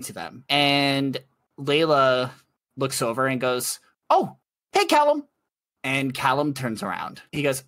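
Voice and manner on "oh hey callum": put-on much higher, louder cheerful girl voice; a high, sunny hello to a colleague; bright npc greeting. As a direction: call out to a friend, way up high.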